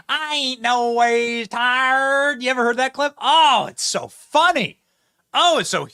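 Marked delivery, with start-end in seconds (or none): 0.1-2.4 very slow, drawn-out mocking Southern drawl; mock Southern preacher accent. Host imitating Hillary's church clip, slow mock Southern drawl. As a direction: stretch each word like a mock gospel preacher. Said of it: "have you ever heard the Hillary Clinton".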